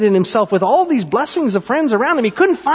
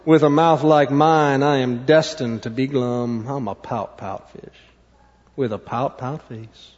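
low, fading gloomy fish; glum pout-pout fish; 10 semitones lower than usual. Acted. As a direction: sink down in resignation, then blub. Glum fish resigns himself.

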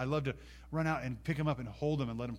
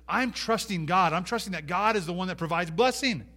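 higher, louder voice of conviction; trusting declaration; 6 semitones higher, 6 dB louder than usual. Acted. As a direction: proclaim trust with rising force. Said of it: "That's an attitude that says".

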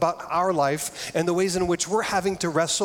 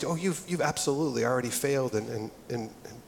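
softer, fading to a near hush; reassuring; 3 semitones lower, 6 dB quieter than usual. Retold or imitated. Counselor Sandy reassuring the scared couple, soft and gentle. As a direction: break the news kindly and gently. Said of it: "with the most reassuring way".

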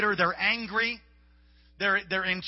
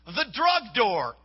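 ordinary talk, nothing marked

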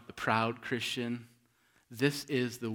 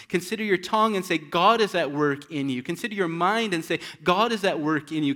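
rousing preaching; 5 semitones higher, 8 dB louder than usual. Acted. Preacher urging congregation, rising.

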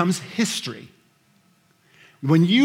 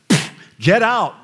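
dismissive scoff; 4 semitones higher, 6 dB louder than usual. Retold or imitated. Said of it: "They would have went".

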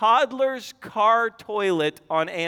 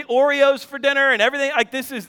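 ordinary talk, nothing marked

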